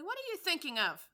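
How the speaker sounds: shrill, loud scolding; severe. Acted. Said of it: "said the mouse to Alice severely".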